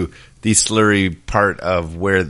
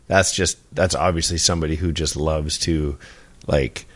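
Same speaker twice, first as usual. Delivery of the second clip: normal talking voice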